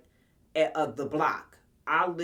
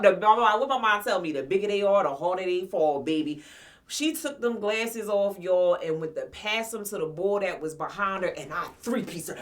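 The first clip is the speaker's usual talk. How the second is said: ordinary talk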